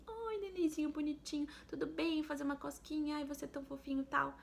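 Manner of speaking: put-on high, cooing baby talk to a newborn; mother cooing in a high voice at her newborn. Shown as a demonstration. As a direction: coo at a tiny newborn, pitched high. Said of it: "a gente fala assim".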